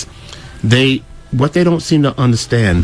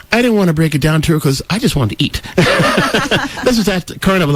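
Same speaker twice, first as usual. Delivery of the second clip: normal talking voice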